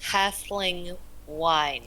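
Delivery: slow, terse order; Kanari ordering. Acted. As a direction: drag the words out flatly as a blunt order. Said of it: "she just kinda goes".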